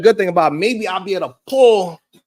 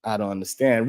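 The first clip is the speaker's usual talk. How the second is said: whisper; 8 semitones lower, 5 dB quieter than usual. Real, not acted. Aside whisper to chat.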